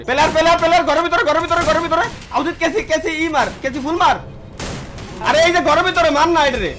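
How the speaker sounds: urgent callout shout. Real, not acted. Urgent callouts during firefight.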